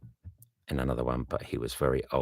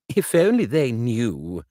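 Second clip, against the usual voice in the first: a hint of a higher, eager Bingley; young friend asking kindly; Bingley, lightly raised; 7 semitones higher, 8 dB louder than usual. Bingley asks Darcy about the letter, lightly higher.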